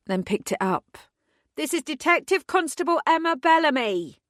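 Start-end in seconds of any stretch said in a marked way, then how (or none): 1.6-4.2 booming through a megaphone. A narrator's reading. Detective booming at wolves through a megaphone. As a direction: boom it out over the bridge. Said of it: "my voice boomed out across the bridge".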